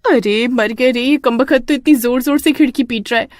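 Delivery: waking in terror at the knocking; frightened wife. Acted. Sushma scared by banging. (डरी हुई पत्नी, ऊँची आवाज़)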